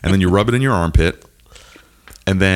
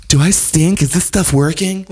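imitating wife; 5 semitones higher than usual. Imitates wife asking for sniff check.

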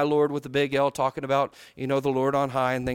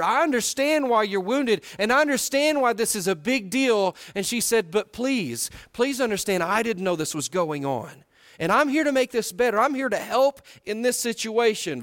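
raised, pressing appeal of a humble wife; earnest pleading woman; 6 semitones higher than usual. Acted. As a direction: plead with him to see she understands. Voices her plea to David.

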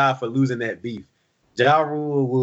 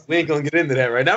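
quick brush-off; much faster than usual. Retold or imitated. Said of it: "You were like".

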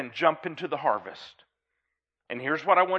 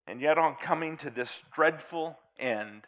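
poem recitation; 3 dB louder than usual. Acted. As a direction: recite it gravely, line by line.

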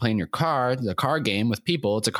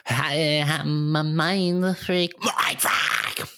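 slow, high showman catchphrase; Chris Angel; 9 semitones higher, much slower than usual. Retold or imitated. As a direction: draw out the magician's catchphrase. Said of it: "Does anybody here like Chris Angel".

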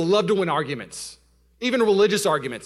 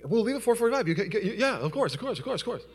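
wife's breezy promise; 5 semitones higher, 4 dB quieter than usual. Retold or imitated. Wife's breezy promise, voiced by husband.

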